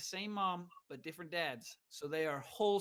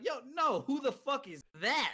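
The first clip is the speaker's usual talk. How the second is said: higher, incredulous inner voice; retold alarm; 7 semitones higher, 3 dB louder than usual. Newcomer thinking to himself after a voice in the dark, startled. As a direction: act out a startled reaction in your head. His alarmed thought on hearing a voice.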